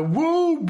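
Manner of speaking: loud high whoop out the window. Retold or imitated. Driver hanging out the car window, loud whoop. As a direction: let out a happy whoop.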